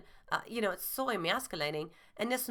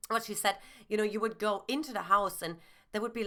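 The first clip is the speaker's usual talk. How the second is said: ordinary delivery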